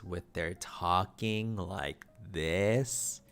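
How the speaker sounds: "talking like this" drawn out very slowly and evenly, each word placed; slow eloquent demo. Shown as a demonstration. Showing viewers how eloquent people speak, very slow and poised. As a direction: mimic a poised smooth speaker. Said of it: "My mind is going crazy saying that, talking like this".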